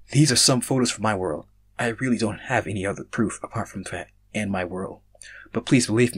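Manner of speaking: ordinary delivery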